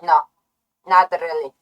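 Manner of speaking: usual speaking voice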